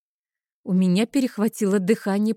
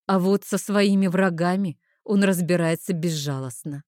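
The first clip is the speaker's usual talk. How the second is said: ordinary delivery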